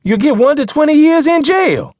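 very high, loud warning shout; shouted threat. Acted. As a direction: shout it to scare the driver. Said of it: "Mister Barron shouted".

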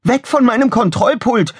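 very high-pitched shout; Lexi calling out. A narrator's reading. Pilot drives both men off her console, high shout. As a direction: shout them away, voice high. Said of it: "rief Pilotenoffizier Lexi".

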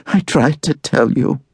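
high, lightly voiced tearful girl; a hint of tears in it; mildly tearful daughter pleading with father; sobbing. A narrator's reading. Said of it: "she sobs".

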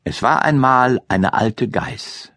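usual speaking voice

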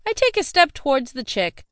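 ordinary delivery, nothing marked

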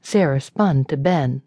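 usual speaking voice

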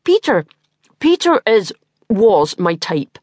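talking through a mouthful of cake; mouth full. Acted. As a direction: speak round a full mouth. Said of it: "talk with my mouth full".

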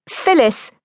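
sharp, high-pitched reprimand; snapped. A narrator's reading. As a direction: snap the name at a sister. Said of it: "snapped my mother".

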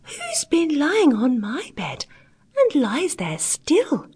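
high, slow little bear voice; astonished teeny tiny bear. A narrator's reading. Littlest bear finds a sleeper, high astonished squeak. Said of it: "tiny voice of astonishment".

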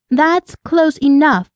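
high, slow warning with a touch of alarm; mildly panicked, stretched-out warning; panted warning. A narrator's reading. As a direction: warn him off, pitched high and drawn out. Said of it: "she panted".

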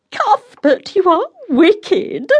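high delighted mock-scolding squeal. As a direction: squeal it, mock-scandalised and thrilled. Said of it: "she squealed".

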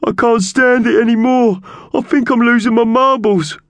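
very high, loud wailing bird voice; sobbing. A narrator's reading. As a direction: wail it like a sobbing magpie. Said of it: "he began to sob".

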